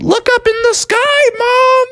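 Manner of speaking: put-on very high, yelled child voice; Froggy. Child yells to mom from the sky, high yell. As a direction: holler it down from high above. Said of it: "And then Froggy yelled".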